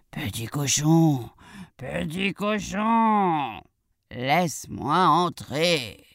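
slow, drawn-out wolf call. Acted. Wolf knocks at the piglet's door, slow drawn-out call. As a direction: stretch the syllables as the coaxing wolf. Wolf calls at the brick house.

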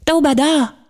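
high-pitched call across the room; high, eager shout without much extra volume. A narrator's reading. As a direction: cry out the name to someone across the room. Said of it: "Elle acclamait à travers la pièce".

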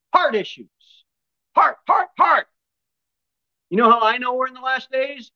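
high, loud pulpit fervor; full-force preaching. Real, not acted. Preacher correcting the congregation on the last days, full-force preaching. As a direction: drive each question home in a high preaching cry.

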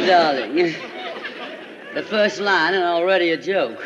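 ordinary talk

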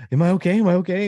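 loud, high-pitched imitation of panic; freaking out. Rookie stripping for decontamination asks the med team, mock panic. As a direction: blurt it twice like a panicked rookie. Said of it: "he's, like, freaking out".

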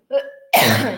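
hacking real cough; loud cough. Real, not acted. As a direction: cough loudly. Still coughing after drink.

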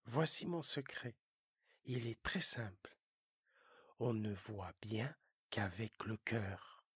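low, confiding fox voice. Acted. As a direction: give the secret like a wise fox.

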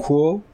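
Kuo rising in pitch; second tone. Shown as a demonstration. Teacher models Kuo for learners, rising second tone. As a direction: lift Kuo upward like a question. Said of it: "avec un deuxième ton".

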